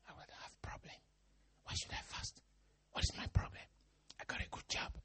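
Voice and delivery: mocking rich man in a whisper; lightly voiced smug believer, hushed; whispered smug excuse. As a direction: whisper the excuses, all self-satisfied. Voicing smug rich churchgoer.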